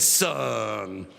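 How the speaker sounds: very low, drawn-out pastor voice; slow, deep, self-assured elder; old pastor. Retold or imitated. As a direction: drop deep and answer the challenge. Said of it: "He said".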